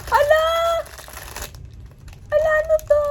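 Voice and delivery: high, loud cry of surprise; startled shout. Real, not acted. Vlogger puzzled by a new figure, startled shout. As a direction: yell out in sudden astonishment. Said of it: "Ba't ako sumigaw?".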